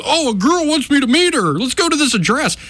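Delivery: high, eager and gullible, as a clueless fool; naive idiot voice. Retold or imitated. Imitating a gullible idiot taking the bait, high and eager. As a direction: play the dumb guy happily falling for it. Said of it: "if some idiot had gone".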